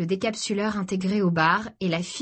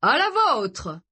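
higher-pitched cheers to the group; exclaimed toast; 5 semitones higher, 4 dB louder, much slower than usual. A narrator's reading. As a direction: call out a toast as drinks go round. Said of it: "s'écria Mark en distribuant les cocktails". (toast lancé à voix haute)

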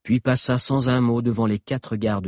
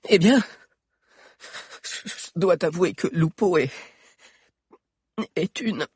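stalling; 11 semitones higher, 3 dB louder than usual. Acted. Valère stalls.

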